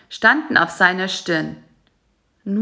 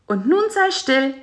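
high fly voice; 6 semitones higher than usual. A narrator's reading. Bisi voice.

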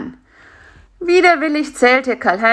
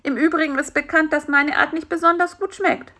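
higher, quick character voice; lightly voiced anxious beetle; 9 semitones higher, faster than usual. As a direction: interject hastily in a slightly raised voice.

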